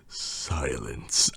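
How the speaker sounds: low, soft, ominous word; hushed and deep; sudden hush. A narrator's reading. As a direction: drop right down as the crowd goes still. Narrator marks the sudden hush.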